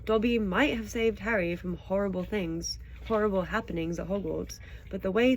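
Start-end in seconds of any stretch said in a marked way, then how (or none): none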